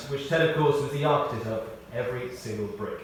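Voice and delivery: normal talking voice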